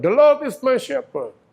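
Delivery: "The Lord is my shepherd" with "Lord" ringing high and loud, slowly; proclaimed verse. Shown as a demonstration. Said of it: "climactic verse".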